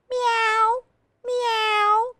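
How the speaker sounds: very high, drawn-out meows; cat meowing. A narrator's reading. Reader voices a kitty cat for children, high meowing. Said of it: "cried the cat".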